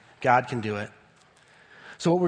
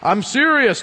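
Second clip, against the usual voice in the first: mock stern; 6 semitones higher, 5 dB louder than usual. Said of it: "Does he say".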